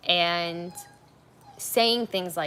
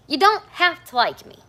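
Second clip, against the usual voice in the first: loud, high, emphatic repeat; raised voice, firm and deliberate; louder for the back; 10 semitones higher, 11 dB louder than usual. Real, not acted. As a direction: hammer the line home again, loudly. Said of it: "a little louder for the people in the back".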